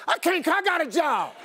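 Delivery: high-pitched comic protest; indignant husband. Retold or imitated. Husband's indignant reply to wife.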